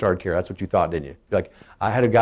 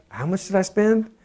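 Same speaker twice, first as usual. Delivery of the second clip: mock shock; 8 semitones higher than usual. Mocking shopper's shock.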